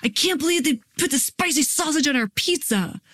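high-pitched mock whine of frustration; exasperated outburst, played up; frustrated kid. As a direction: act out a kid getting worked up. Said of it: "they start to get frustrated".

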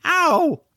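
sharp high yelp of pain. Acted. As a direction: cry out as if just bitten. Man bitten by dragon.

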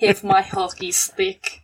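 put-on slow, whispery Russian accent. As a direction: whisper it slowly like a stage Russian. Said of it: "Can you do Russian?".

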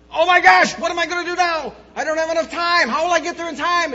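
high, loud, fast panicked cries; mock frantic alarm at the clock. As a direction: blurt it out like you just overslept. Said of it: "you become frantic".

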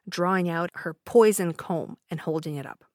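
ordinary delivery, nothing marked

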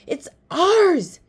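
high, breathless yell; panting. A narrator's reading. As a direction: yell it out mid-run. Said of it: "panted Pong".

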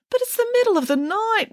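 high-pitched whiny protest; drawn-up plaintive whine; moaning. A narrator's reading. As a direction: moan in weary complaint. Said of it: "moaned Mary".

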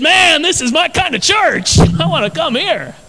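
loud, high, delighted exclaim; excited exclaim. Retold or imitated. Pastor delighted at a bat-smacking church, loud and high. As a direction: cheer it out like a man who found his church. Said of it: "I said".

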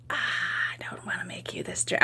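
retold dad, whispered; hushed imitation of a reluctant dad. Dad refusing to make the drink, whispered.

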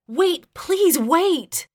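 high, drawn-out desperate plea; slow, high, pleading cry; panicked. A narrator's reading. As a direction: beg in panic, very slowly stretched. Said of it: "with even more panic".

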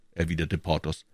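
usual speaking voice

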